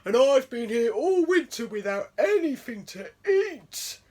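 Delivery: loud bear voice booming. A narrator's reading. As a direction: boom like a starving bear. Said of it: "boomed the bear".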